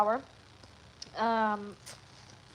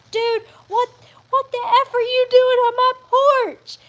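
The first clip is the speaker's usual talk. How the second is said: high, loud startled yell; 12 semitones higher, 10 dB louder than usual. Retold or imitated. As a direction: shout at the raccoon. Yelling at raccoon on porch.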